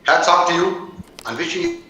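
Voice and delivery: ordinary delivery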